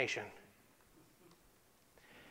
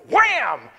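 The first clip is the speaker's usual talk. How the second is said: much louder, higher mock bang; loud slam sound; 7 semitones higher, 13 dB louder than usual. Acting out the pilot shutting the curtain, loud higher bang. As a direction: belt it like a curtain slamming.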